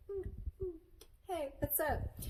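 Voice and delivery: high-pitched, mock-flirty greeting to the camera; playful selfie poser. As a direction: put on a silly, high selfie voice. Mimes posing for a selfie.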